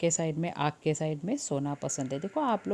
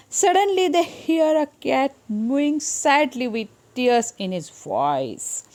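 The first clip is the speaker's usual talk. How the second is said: put-on very slow, loud reading for children; storybook reading; 6 semitones higher, 7 dB louder, much slower than usual. A narrator's reading. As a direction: read it out slowly for the class. Story narration read aloud.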